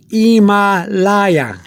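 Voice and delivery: "Himalaya" very slowly as ee-mah-LAH-yah; Spanish "Himalaya" opening on "ee", drawn out; silent H. Shown as a demonstration. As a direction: stretch "Himalaya" out for learners, no H. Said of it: "that's how you say it".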